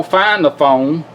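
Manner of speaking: ordinary talk, nothing marked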